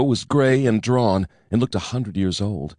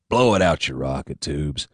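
snarled; 8 semitones lower than usual. A narrator's reading. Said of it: "Stingo snarled".

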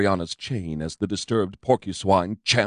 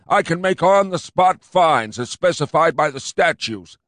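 higher, louder pompous cop; fat cop insisting; 8 semitones higher, 4 dB louder than usual. A narrator's reading. Fat cop insisting to his partner, pompous.

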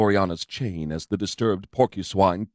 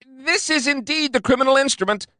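much higher squeaky skinny cop; squeaked. A narrator's reading. Skinny cop finding the computer, squeaking. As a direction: squeak it out triumphantly. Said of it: "he squeaked".

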